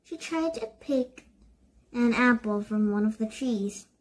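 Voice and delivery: usual speaking voice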